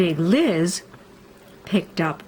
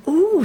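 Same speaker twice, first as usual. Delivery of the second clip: high loud wondering ooh; delighted ooh; 11 semitones higher, 5 dB louder than usual. A narrator's reading. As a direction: gasp of delight. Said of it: "said little Nell".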